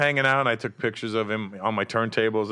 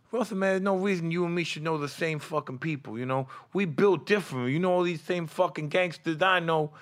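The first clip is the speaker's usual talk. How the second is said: Mike Tyson impression; 7 semitones higher than usual. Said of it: "Mike's like".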